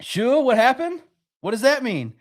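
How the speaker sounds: mock innocent.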